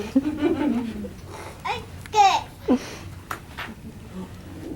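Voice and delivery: giddy bird cackle. A narrator's reading. Maisie cackles at the circus.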